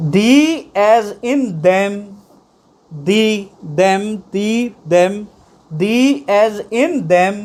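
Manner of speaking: "the" and "them" repeated slowly, word by word; slow pronunciation drill. Shown as a demonstration. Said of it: "the की sound आ रही है इसमें".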